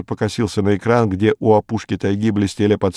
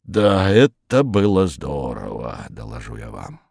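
contented, tipsy approval; 7 semitones lower, slower than usual. A narrator's reading. Ivan Ivanovich after a glass of cognac, benevolent and contented. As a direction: draw it out with relish, then drop low. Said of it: "багровый и благожелательный от стаканчика коньяка".

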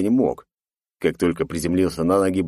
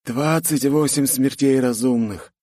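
grim report; 6 semitones higher, slower than usual. A narrator's reading. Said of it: "сообщил мужчина".

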